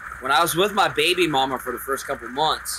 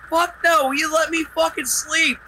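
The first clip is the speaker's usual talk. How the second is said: annoyed yell; 14 semitones higher, slower than usual. Retold or imitated. Yelling at grandma through the door.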